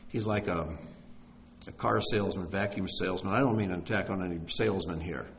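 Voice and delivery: ordinary talk, nothing marked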